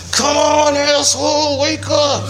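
high, loud shout from above; a big cosmic shout at the sleeping drunk; booming wake-up call. Acted. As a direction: yell him awake like an impatient god. God's wake-up call, acted.

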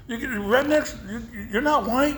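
high, stammering incredulity; retold bafflement. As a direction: sputter it as the confused friend. Friend baffled in retold quarrel.

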